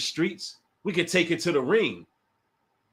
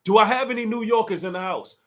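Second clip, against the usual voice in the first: ordinary talk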